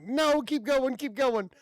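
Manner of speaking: high eager urging. Retold or imitated. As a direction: cheer him on to keep going. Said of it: "and I'm like".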